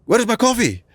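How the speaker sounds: squeaky put-on boss voice asking where's my coffee; demanding boss. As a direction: ape the boss barking for his coffee down the phone. Boss voiced in phone story.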